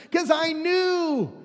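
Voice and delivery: high, loud, exasperated whine; complaining Jonah. Retold or imitated. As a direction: vent frustration at God. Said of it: "he complained to the Lord".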